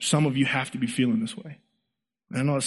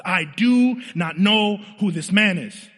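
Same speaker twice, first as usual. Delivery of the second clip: slow, emphatic oath, pitched up; acted solemn denial, drawn out and higher; sworn denial; 6 semitones higher, slower than usual. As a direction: swear it word by weighty word. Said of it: "he said it with an oath".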